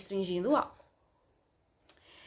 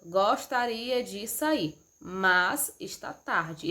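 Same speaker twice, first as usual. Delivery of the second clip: slow example sentence; much slower than usual. Shown as a demonstration. Teacher reading an example sentence to students, slowly. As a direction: read slowly, with a clear pause at the comma. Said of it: "Esse mas".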